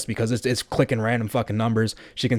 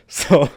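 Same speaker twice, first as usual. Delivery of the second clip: real laugh; 5 dB louder than usual. Real, not acted. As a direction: burst of real laughter breaking through. Laughing at his own prank.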